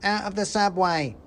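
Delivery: high squeaky aside; mouse soldier. Acted. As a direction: chip in like a tiny soldier. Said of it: "said the first".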